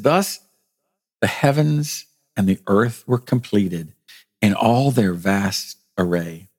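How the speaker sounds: solemn, spaced-out pulpit reading; scripture reading. A narrator's reading. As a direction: read scripture aloud, phrase by phrase. Reads scripture aloud.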